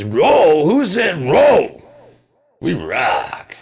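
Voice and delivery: mock tough guy, loud and high; a hint of a drill-sergeant rocker; gruff friend. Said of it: "He just says".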